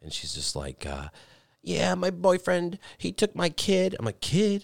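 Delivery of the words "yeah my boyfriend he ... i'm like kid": put-on much higher, slow girl voice; girly voice. Retelling the girl complaining in the car higher and slower. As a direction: drag out her sad story in a high young voice. Mimicking girl's story.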